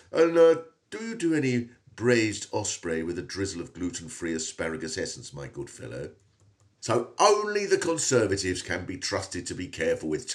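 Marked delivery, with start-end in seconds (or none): 0.1-6.1 posh voice. Retold or imitated. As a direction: let the posh slip out.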